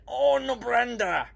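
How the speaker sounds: high, loud, slow battle cry; firing call. Acted. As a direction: call the torpedo out like a charge. Crew firing the torpedo.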